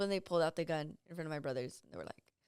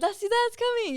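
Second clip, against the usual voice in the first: high, louder mock alarm; joking warning; 7 dB louder than usual. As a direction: call it out like kids playing. Kids joking, pretend alarm.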